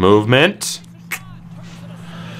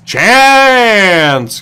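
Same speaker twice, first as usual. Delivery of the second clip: excited yell; 7 semitones higher, 4 dB louder than usual. Real, not acted. Scoring chance in game.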